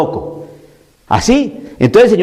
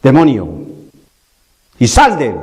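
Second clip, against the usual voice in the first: spaced-out stern command, words falling low; preacher voicing Christ's rebuke with authority; 5 semitones lower, slower than usual. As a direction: order the demon out as one who has full authority. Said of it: "Con autori-, autoridad".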